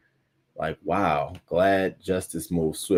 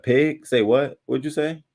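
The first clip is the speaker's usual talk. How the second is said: offended retort; 5 semitones higher than usual. Retold or imitated. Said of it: "I'm like".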